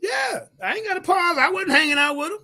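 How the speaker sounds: high-pitched, defensive protest; indignant denial. Real, not acted. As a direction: deny it hotly, pitch way up. Rejecting a listener's pause.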